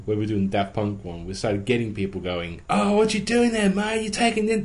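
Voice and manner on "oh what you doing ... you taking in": high-pitched mock curious onlooker; nosy passer-by impression. Passer-by butting in on a costume shoot, mock nosiness. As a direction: imitate an intrusive bystander, pitched well up.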